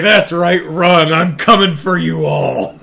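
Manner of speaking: loud, high gloating threat; high menacing shout; triumphant yell. Retold or imitated. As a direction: bellow it at fleeing enemies. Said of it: "she's like".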